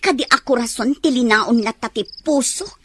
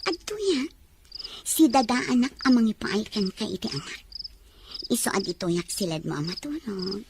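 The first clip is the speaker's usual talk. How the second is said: soft coaxing; 5 semitones lower, 8 dB quieter than usual. Acted. Wife coaxing husband at night.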